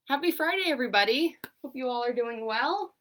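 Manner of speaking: upbeat greeting. Acted. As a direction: greet the class with big energy. On-camera greeting after set-up.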